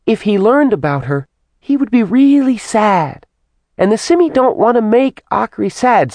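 put-on high, chattering little girl voice; childlike demon voice. A narrator's reading. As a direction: tattle on her like a child. Simi character voice.

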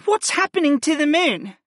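high-pitched, worried character question; alarmed. A narrator's reading. Nia sees the moon and asks Griff, alarmed. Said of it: "flashed in alarm".

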